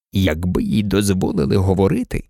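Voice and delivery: ordinary delivery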